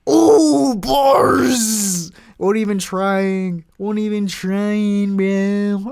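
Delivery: loud, high, drawn-out mock hype yell; goofy hype outburst. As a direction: stretch it out big, clowning around at full volume. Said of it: "I'll stop being weird".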